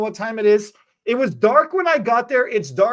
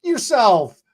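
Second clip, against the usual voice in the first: mock retort; 7 semitones higher, 5 dB louder, slower than usual. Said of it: "I'm like".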